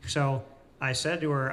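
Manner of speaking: ordinary delivery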